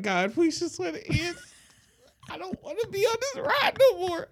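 very high, whiny begging; mock desperate pleading. Teller begging for the ride to end, high hammed-up wail.

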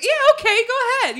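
high-pitched breezy send-off; imitated eager dismissal. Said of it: "It wasn't quite that aggressive".

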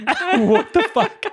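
high, slow imitation of disbelief; laughing, high-pitched retold reaction; mock bafflement. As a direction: act out stunned confusion through a laugh. Acts out Leaf's baffled reaction.

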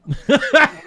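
loud burst of laughter. Real, not acted. Laughs at joke about fever.

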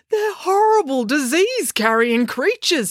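high, slow, disgusted dad voice; fussy dad. Acted. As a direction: spit out the horror of bugs, high and slow.

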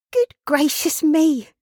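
high, slow exclamation of a dismayed daughter; astonished cry. Acted. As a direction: draw it out in high-pitched astonishment. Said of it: "cried Meg".

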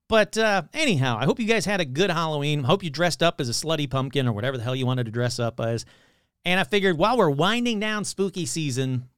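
usual speaking voice

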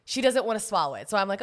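normal talking voice

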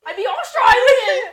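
high, loud, slow giddy announcement; gleeful yell; excited laughing declaration. Real, not acted. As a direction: shout it out, laughing at the idea. Picking a fun accent.

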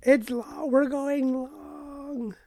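slow, high, whiny moan; whiny drawn-out complaint. Real, not acted. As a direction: drag it out in protest. Complaining about length.

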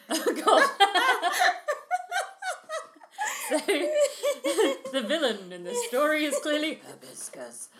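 giggling fit mid-sentence; laughing. Real, not acted. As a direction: talk through uncontrollable laughter. Cracking up over hibiscus.

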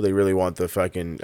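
ordinary delivery

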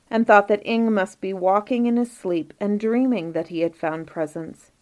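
plain reading voice

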